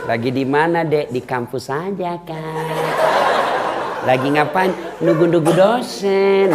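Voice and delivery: mock sweet drawling replies; coy phone chat. Girl chatting with her boyfriend on the phone, mock coy. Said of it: "jangan bikin mendayu-dayu suaramu".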